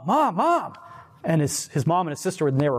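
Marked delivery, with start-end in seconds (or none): 0.1-0.7 high, urgent mock call; calling for mom. Son just woken from a dream runs to his mom, calling out.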